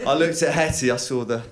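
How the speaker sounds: ordinary talk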